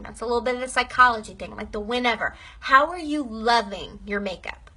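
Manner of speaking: usual speaking voice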